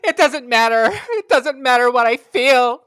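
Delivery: high, loud, anguished melodrama; dramatic heated fight. Acted. Student in a heated lovers' fight, loud and anguished. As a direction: cry it out as overwrought American drama. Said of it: "two students having a heated fight".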